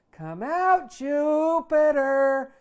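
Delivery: long, high, very loud operatic call of the name; mother calling her son across the street, belted at full lung; belting call. Acted. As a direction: belt it out like an opera singer. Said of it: "she yells".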